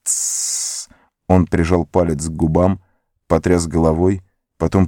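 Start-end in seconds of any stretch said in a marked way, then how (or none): 0.0-0.9 long unvoiced hiss; hissing shush. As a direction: hiss for quiet. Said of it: "зашипел Горлум".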